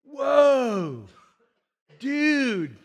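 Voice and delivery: drawn-out surfer awe; Crush the turtle. Retold or imitated. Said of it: "What was that turtle's name? Crush".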